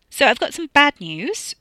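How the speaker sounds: high, loud, untroubled announcement; not upset. A narrator's reading. Katie breaks bad news to the family, not upset. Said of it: "not in a tone of voice that suggested she was upset".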